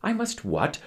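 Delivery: high-pitched disbelieving question; incredulous. A narrator's reading. Captain frowning at crewman's demand, incredulous. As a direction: ask it in disbelief.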